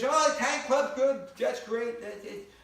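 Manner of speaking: hammed-up fake optimism; forced cheer. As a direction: oversell it nervously. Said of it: "He goes".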